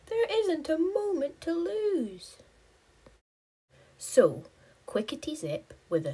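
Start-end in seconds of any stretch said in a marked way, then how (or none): 0.1-2.4 urgent. Acted. Urgent hero line.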